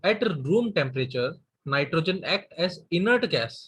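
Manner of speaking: dictating with long gaps between words; slow dictation. Shown as a demonstration. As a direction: give each word time to be written. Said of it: "dictating key point slowly". (रुक-रुककर लिखवाते हुए)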